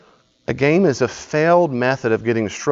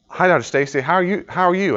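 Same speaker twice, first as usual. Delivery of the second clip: higher, bright eager hello; trying hard to impress the professor; young student gushing at his professor; eager greeting; 8 semitones higher, 4 dB louder than usual. Retold or imitated.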